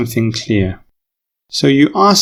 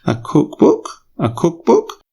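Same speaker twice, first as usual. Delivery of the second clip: "cookbook" swept sharply up in pitch; rising question intonation; 6 semitones higher than usual. Shown as a demonstration. Host asking Harry to clarify, rising question intonation. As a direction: lift "cookbook" high at the end to query it. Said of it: "so his voice was rising".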